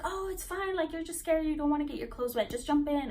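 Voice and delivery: high mock peer-pressure voice; pushy, high-voiced retelling; dismissive coaxing. Onlookers dismissing her fear, retold.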